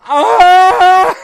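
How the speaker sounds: high, loud laughing shriek; panicked scream. Retold or imitated. Fleeing the band girl, high loud panicked shriek. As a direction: shriek and run off. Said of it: "turned around and went".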